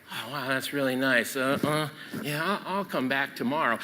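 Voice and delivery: soft, low, offhand musing; casual putting-off. Acted. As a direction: voice a half-interested passerby, quietly. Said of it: "Don't look at something and say".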